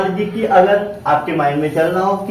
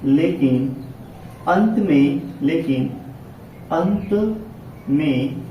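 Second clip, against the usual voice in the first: word-by-word dictation with long pauses; 4 dB quieter, slower than usual. Real, not acted. Teacher writing out the rule for students, dictation. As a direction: dictate the rule slowly, repeating each piece.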